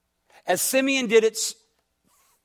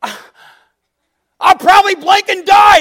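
slow, loud, high angry outburst; upset shout; 9 semitones higher, 14 dB louder, much slower than usual. Retold or imitated. Young man fed up with the preacher's questions, shouting. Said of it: "He got really upset".

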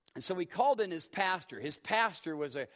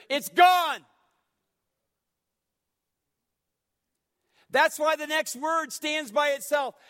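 loud, high, ringing pulpit proclamation; fiery revival preacher crying out; full-force preaching; 7 semitones higher, 7 dB louder than usual. Acted. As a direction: preach it out to the back row.

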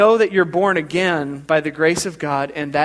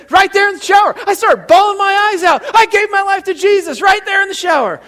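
very high, loud, excited parishioner; Teresa impression; 8 dB louder than usual. Churchgoer announcing her conversion to pastor, shouted high impression. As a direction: shout it at the top of the register, imitating her. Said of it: "That's exactly how she talks".

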